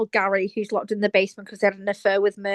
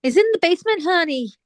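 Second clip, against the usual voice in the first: higher, put-on Alabama voice for He's in the basement, honey; Gail's accent; 6 semitones higher than usual. Asked to answer as Gail, she switches to the mock Alabama voice. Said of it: "I wanted you to say it as Gail".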